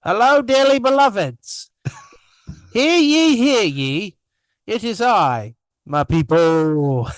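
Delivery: high, loud put-on Australian accent; mock Australian greeting, pitched high; Aussie accent. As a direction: greet them like a showy Australian. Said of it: "I went and did an Aussie accent".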